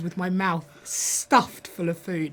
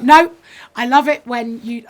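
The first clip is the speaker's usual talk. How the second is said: director's eager insistence; 5 semitones higher, 12 dB louder than usual. Retold or imitated. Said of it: "He was like".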